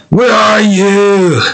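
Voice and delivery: high, slow sung ogre voice; Shrek voice. Shown as a demonstration. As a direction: sing it like a cartoon ogre. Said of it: "It's sung by Shrek".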